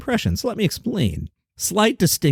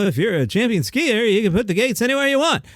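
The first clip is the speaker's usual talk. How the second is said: higher, quicker sing-song Seinfeld read; typical Seinfeld impression; 9 semitones higher than usual. Shown as a demonstration. Host showing how most people do Seinfeld, lightly. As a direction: mimic the usual Seinfeld cadence. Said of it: "So many people would do the".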